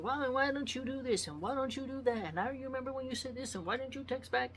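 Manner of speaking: whiny string of complaints from the wife; nagging wife. Retold or imitated. Drunk wife nagging her husband, mock whiny. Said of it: "And she's like".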